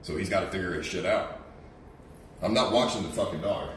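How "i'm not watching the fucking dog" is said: ordinary talk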